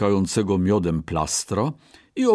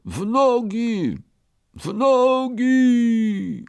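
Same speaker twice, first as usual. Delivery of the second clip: long, high-pitched bear bellow; booming bear cry; 13 semitones higher, much slower than usual. A narrator's reading. Bear urges all to run, drawling booming cry. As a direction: stretch each word like a bellowing bear. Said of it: "rozwlekłym, tubalnym, niedźwiedzim głosem".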